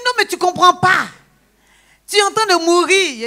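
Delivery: high, loud insisting doctor; insistent doctor. Retold or imitated. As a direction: press the point in alarm. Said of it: "Il dit". (le médecin insiste, fort et aigu)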